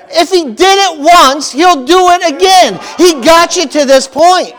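loud, high, slow preacher's call; fiery preaching. Acted. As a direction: rouse the congregation. Preaching builds up.

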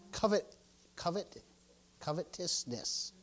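"covetousness" sounded out slowly and softly; soft, halting practice of "covetousness"; careful enunciation. Real, not acted. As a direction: feel out "covetousness" piece by piece. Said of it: "I'm enunciating".

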